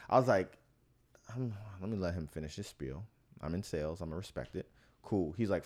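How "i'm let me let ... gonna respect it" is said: hushed, low aside to himself; inward aside. Retold or imitated. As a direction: murmur it like a private thought. Unspoken thought while hearing sales pitch.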